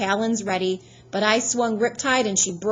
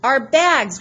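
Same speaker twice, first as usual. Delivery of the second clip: higher, louder alarmed gasp of words; sudden realization; 6 semitones higher, 8 dB louder than usual. Acted. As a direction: blurt it as it hits him. Grover suddenly remembers their luggage.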